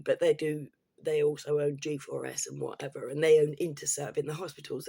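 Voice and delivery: ordinary talk, nothing marked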